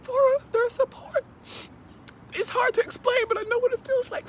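high, loud, emotional and close to crying; tearful. Real, not acted. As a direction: struggle through rising tears to speak. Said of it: "before I start crying".

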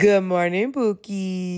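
talking doll. Acted. Toy greets child by name.

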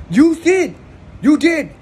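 high, loud accusing you did; mock insistent shout. Hitman insisting to Tony, high and loud. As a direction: hammer it home loudly.

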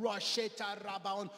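loud, high mock tongues with a long wailed wow; imitated praying in tongues. Preacher showing groaning in the Spirit, loud imitated tongues. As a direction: burst into imitated ecstatic prayer. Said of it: "unaussprechliche Worte sagen".

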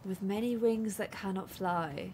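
hushed whisper to herself. Real, not acted. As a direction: whisper the riddle under your breath. Repeating the riddle clue to herself.

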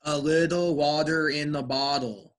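smooth D sound in "bottle", as "boddle". Shown as a demonstration. Teacher respells the sentence for a student, T as D. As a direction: say "little water" as "liddle wadder". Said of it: "imagine saying it like this".

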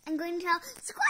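quick words ending in a loud bird screech; fast threat cut by squawk. Acted. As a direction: rush it, then screech.

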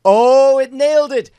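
high, loud burst of delight; thrilled it worked. Real, not acted. Delighted at app success.

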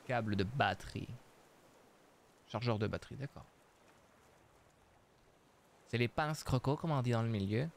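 normal talking voice

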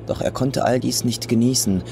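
ordinary delivery

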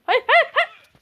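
high loud repeated cry; real panicked shout. Real, not acted. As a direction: yell no in panic. Falling in game.

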